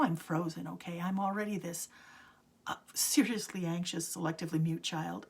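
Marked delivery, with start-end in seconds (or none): none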